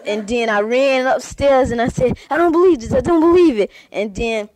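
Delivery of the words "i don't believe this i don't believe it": panicked disbelief. Acted. Panicked boy running upstairs.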